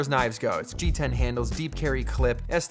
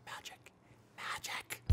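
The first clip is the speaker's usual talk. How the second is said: whisper; 21 dB quieter, slower than usual. Acted. Whispered reveal of ring trick.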